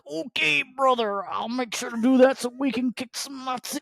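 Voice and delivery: high, theatrical hello brother; character voice. Retold or imitated. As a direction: ham it up like a costumed hero. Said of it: "He's like".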